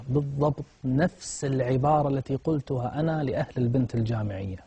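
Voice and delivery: normal talking voice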